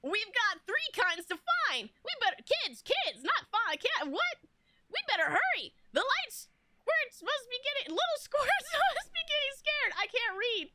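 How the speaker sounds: squeaky, high-pitched cat sidekick voice; protagonist cat voice. Shown as a demonstration. Streamer reading game dialogue to viewers in a high cat voice. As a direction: pitch it way up like an excitable cat companion. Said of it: "my inner protagonist cat voice".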